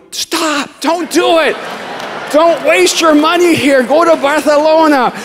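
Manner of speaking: pleading shout. Pretend. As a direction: shout at the visitors to turn back. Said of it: "I wanna go".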